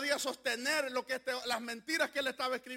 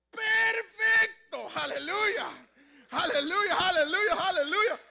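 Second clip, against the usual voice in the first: loud, slow, rising sermon cry; full-force Pentecostal preaching; fiery preaching; 7 semitones higher, 9 dB louder, slower than usual. Acted. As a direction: preach it out to the congregation.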